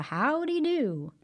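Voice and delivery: high, slow friendly howdy; friendly greeting. A narrator's reading. Narrator voicing Brer Rabbit greeting folks on the road, friendly and high. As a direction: greet them like a cheerful rabbit.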